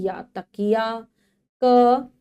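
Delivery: ordinary delivery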